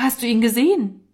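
mildly alarmed question; startled. A narrator's reading. Norman asks the small boy about the missing friend, startled. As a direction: ask urgently with a touch of fright. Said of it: "rief Norman erschrocken".

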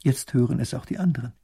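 ordinary delivery, nothing marked

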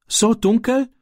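frightened, high stammer. Acted. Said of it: "stammelte Ram". (ängstlich gestammelt)

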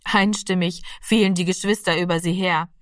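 ordinary delivery, nothing marked

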